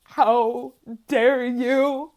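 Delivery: slow, drawn-out, wounded "how dare you"; mock tearful outrage. To her brother holding a gingerbread man, slow mock outrage. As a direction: stretch each word like a betrayed diva. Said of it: "sobbing, and I said".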